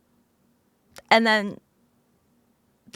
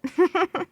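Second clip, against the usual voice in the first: giggle; 5 semitones higher than usual. Real, not acted. Laughing at own yawn.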